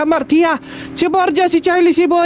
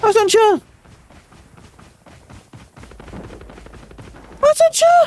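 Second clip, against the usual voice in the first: startled shouts; 9 semitones higher than usual. Real, not acted. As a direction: yelp it out, caught off guard by an enemy. Sudden enemy in the game. (gulat na sigaw)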